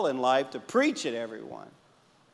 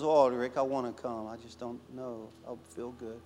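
frail grandmother; 4 semitones lower, 6 dB quieter than usual. Retold or imitated. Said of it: "She goes".

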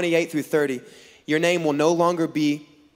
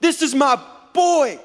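high, drawn-out delighted claim; proud exclamation; 9 semitones higher than usual. Acted. God looking at Jacob, high-pitched proud exclamation. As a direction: crow with pride, stretching it out. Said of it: "God looks at Jacob and is like".

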